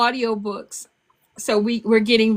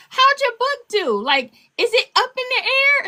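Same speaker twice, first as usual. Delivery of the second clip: put-on high, earnest toddler voice; little granddaughter voice; 11 semitones higher than usual. Granddaughter asking grandma about her book, high child voice. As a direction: ask it like a curious small child, pitched up.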